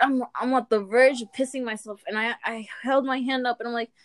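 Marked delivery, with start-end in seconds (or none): none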